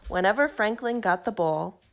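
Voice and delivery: plain reading voice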